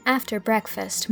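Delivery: usual speaking voice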